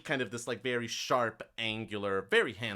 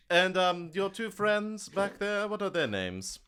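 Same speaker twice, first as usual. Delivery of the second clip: probing NPC; 7 semitones higher than usual. Acted. GM as the official eyeing the two friends at the back. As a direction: coax out the names with mock courtesy. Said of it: "gestures and goes".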